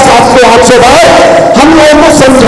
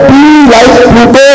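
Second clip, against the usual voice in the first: slow motto; much slower than usual. Shown as a demonstration. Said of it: "motto said slowly".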